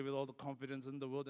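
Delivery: ordinary delivery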